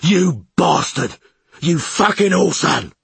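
loud, high curses; furious yelling. A narrator's reading. Cobbin cursing the man rowing away, furious yell. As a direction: hurl abuse across the water. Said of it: "yelled Cobbin".

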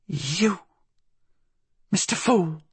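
high-pitched, wary recognition; old woman's recognition. A narrator's reading. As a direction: let the name land after a long pause. Blind woman recognises the intruder.

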